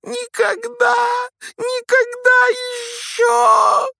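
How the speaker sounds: very high, loud, slow elf wail; sobbing wail. A narrator's reading. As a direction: wail it out in tears. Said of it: "разразился громкими рыданиями".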